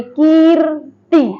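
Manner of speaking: कीर्ति drawn out very slowly, the र clearly after का; slow demo pronunciation. Shown as a demonstration. Teacher to students, slowly sounding out कीर्ति. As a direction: spell out कीर्ति syllable by syllable. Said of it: "का के बाद में निकल रही है".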